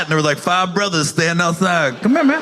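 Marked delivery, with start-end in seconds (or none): 2.0-2.4 higher, louder call of a guy on the corner; a hint of a brother beckoning; street guy calling. Stranger outside the station calling out to him, mildly loud.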